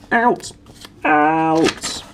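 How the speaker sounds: put-on high, loud bellow at the boy; raging uncle yells; furious shout. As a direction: throw him out with your voice. Vernon throws Harry out.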